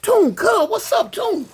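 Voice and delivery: high-pitched hollering to a friend; retold hollering. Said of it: "And he hollering".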